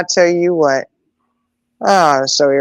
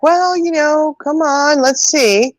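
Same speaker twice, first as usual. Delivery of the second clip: neighbor's inviting voice; 10 semitones higher, slower than usual. Retold or imitated. Voicing the neighbor inviting the boy.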